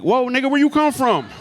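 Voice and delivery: high-pitched startled outburst. Acted. Comic startled by a man rolling out of boxes, high yelp of surprise. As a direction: jump up in pitch, comically alarmed.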